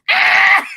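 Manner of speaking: high, loud mock scream; short piercing scream of horror, acted; imitated scream. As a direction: scream like an appalled listener. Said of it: "just screaming".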